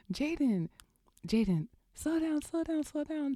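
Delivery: high-pitched calling, no real shout; mock-yell after a runaway child; imitated hollering. Mother calling after speeding son, mock hollering. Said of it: "I'm over here just hollering".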